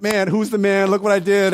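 imagined boastful Jesus, mock bragging. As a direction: show off. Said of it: "He wasn't like".

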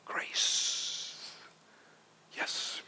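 soft breathy whisper. Real, not acted. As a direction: breathe the word grace. Whispered key word.

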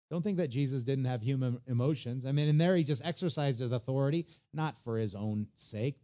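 ordinary delivery

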